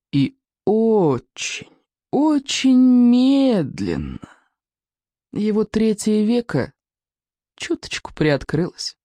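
very slow, rising on очень; slow creeping suspense, high on очень. A narrator's reading. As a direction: draw it out, holding breath. Suspense narration slowing down.

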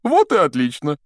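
high, loud, cheery approval; pleased Lockhart. Acted. Teacher accepting a colleague's suggestion, pleased and high.

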